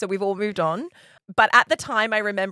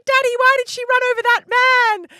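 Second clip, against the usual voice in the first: put-on much higher, louder, quick child's voice; 8 dB louder, faster than usual. Kids asking their dad from the back seat, high child voice. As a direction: squeak it out like a shocked little kid. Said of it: "The kids were like".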